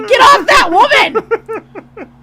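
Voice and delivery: loud high shout, teasing; mock-outraged shout. Wife jokingly yelling at husband to get off her. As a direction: scold him jokingly.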